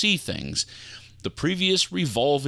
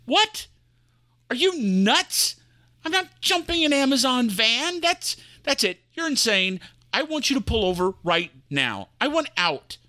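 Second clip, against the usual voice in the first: higher, alarmed protest; panicked passenger; 13 semitones higher than usual. Acted. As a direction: demand the driver pull over.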